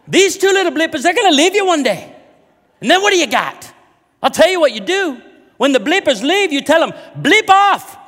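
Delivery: high, loud, hammed-up Irish brogue; tipsy Irish lady giving marriage advice; drunk Irish lady accent. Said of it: "Drunk Irish woman in a pub".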